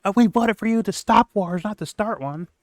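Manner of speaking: high-pitched angry impression; mock anger. Host showing the anger he wants to hear, mock anger. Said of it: "I wanna hear the anger in his voice".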